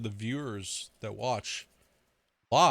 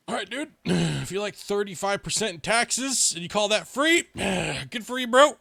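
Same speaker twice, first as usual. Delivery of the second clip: mock the free healthcare claim sarcastically; sarcastic retort; 8 semitones higher than usual. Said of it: "And I'm like".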